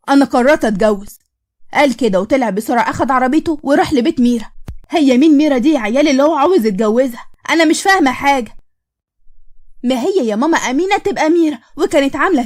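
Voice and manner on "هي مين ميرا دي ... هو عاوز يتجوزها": ordinary delivery, nothing marked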